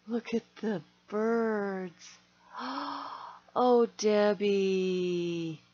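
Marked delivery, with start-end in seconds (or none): 2.5-3.3 gasp. Real, not acted. Sees gift.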